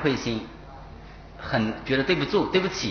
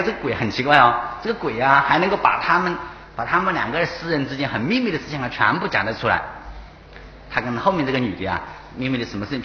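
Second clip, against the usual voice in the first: ordinary talk, nothing marked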